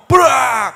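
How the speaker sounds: loud spoken bang; door slam noise. Acted. Preacher voicing the slammed door, loud bang. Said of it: "Pintu dibanting".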